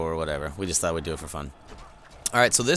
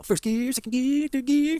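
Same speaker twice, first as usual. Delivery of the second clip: mock yell; 3 dB louder than usual. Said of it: "We'll yell".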